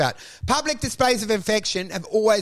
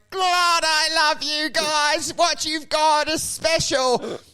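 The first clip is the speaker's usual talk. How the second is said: slow, higher, syrupy gush; mock gushing praise; 6 semitones higher, slower than usual. People commenting on couples' posts, slow mock gush. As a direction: coo it out slowly and oversweet. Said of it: "people that start going".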